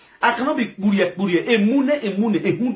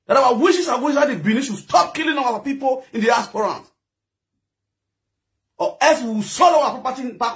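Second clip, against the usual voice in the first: angry shout; 5 semitones higher than usual. Real, not acted. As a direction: shout the demand for justice. Demanding the killing stop.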